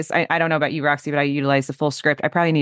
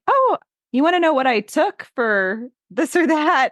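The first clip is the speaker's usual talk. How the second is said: high, drawn-out influencer imitation; singled-out high voice, stretched words; social media influencer; 10 semitones higher than usual. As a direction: mimic an influencer teasing a tip, pitched up and slow.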